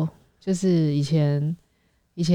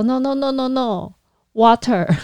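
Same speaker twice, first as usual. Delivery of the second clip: flustered customer; 7 semitones higher than usual. Retold or imitated. Customer refusing vodka, wants water.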